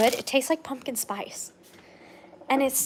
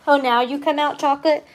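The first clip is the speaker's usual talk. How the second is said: ordinary talk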